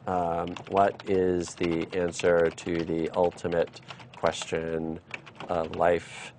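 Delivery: slow, low, quiet word by word; typing-along voice. Real, not acted. Teacher reads aloud the question he is typing, slow and quiet. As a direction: murmur it in pieces, as if typing each word.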